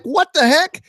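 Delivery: high-pitched mock disbelief; bewildered outburst. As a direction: blurt it high, baffled. Acts out his bewildered reaction.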